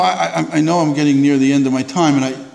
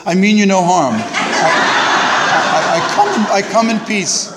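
alien peace greeting; 4 semitones higher, much slower than usual. Retold or imitated. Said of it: "like in the movies, like, for people from outer space".

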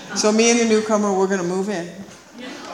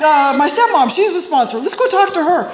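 friend eager; 7 semitones higher, 5 dB louder than usual. Retold or imitated. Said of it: "She goes".

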